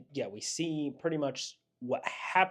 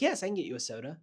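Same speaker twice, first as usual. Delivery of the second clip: eager assistant; 5 semitones higher, 4 dB louder, much faster than usual. Retold or imitated. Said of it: "he's like".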